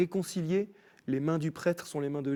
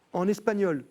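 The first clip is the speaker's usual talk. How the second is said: ordinary talk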